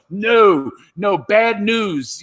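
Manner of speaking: high, drawn-out no, no; slow mock warning. Warning the bowing staff, drawn out and high. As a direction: stretch each word out to warn them off.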